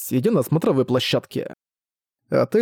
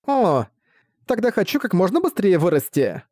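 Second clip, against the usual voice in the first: put-on high eager child voice; determined little girl; 6 semitones higher than usual.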